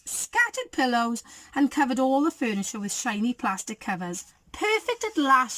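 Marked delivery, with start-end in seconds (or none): 4.5-5.6 high-pitched, satisfied declaration; proud declaration. A narrator's reading. Goldie declares her decorating finished, proud high declaration. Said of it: "she declared".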